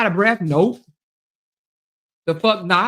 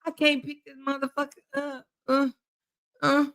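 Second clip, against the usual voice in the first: mock straining; 3 semitones higher, 7 dB quieter than usual. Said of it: "She be like this".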